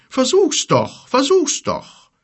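very high, loud goading taunt; combative. Acted. Giant daring the bear to attack, combative. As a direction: dare him, pitched right up. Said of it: "reckte kampflustig das Kinn".

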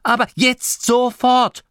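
loud, high scolding that builds to rapped-out orders; angry snapping. Acted. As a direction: order a moper to get moving, furiously. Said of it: "fauchte Grimma". (wütend fauchend, immer schärfer)

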